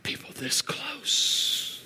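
slow, breathy whispered hush. Acted. Pastor quietly driving the point home, slow whisper. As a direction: let it fall to a whisper. Hushed close of sermon.

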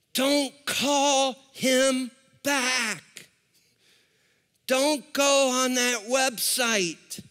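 slow, deliberate warning; measured, drawn-out voice of caution; warning inner voice. Acted. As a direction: space out each word like a conscience warning.

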